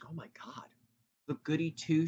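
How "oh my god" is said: breathless whisper at his own stumble; whispered aside. Real, not acted. Tripping over his words, whispered aside. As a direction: whisper in exasperation. Frustrated aside mid-word.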